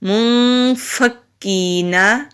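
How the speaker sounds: slow, melodic recitation, syllables drawn out. Shown as a demonstration. Teacher joins the syllables for students, slow recitation. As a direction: chant it slowly as in Quran reading. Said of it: "نون زبر نا".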